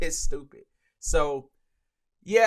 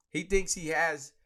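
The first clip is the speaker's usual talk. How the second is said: normal talking voice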